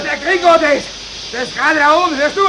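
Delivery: loud, high panicked shouting. Acted. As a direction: yell over the noise. Shouting over steam noise. (laut schreiend)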